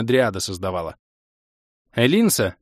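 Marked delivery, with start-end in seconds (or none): 1.9-2.6 a restrained narrated shout, slightly raised; mild call from the stairs. A narrator's reading. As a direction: call her name without really yelling. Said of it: "Ещё с лестницы крикнула". (негромкий оклик с лестницы)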